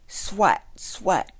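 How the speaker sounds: high, loud, punched words; sharp, loud swats; mop swatting noise. A narrator's reading. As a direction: hit each word like a blow. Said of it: "went her mop".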